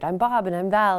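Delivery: a hint of an Irish accent, retold. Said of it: "I recognized that accent".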